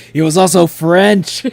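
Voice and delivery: He was also French, said loud and a bit slow; loud joking repeat. Shown as a demonstration. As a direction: boom out the line as a joke. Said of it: "It's just how to say it like that".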